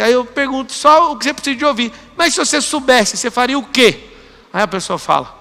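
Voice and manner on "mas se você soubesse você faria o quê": pointed coaching question. Retold or imitated.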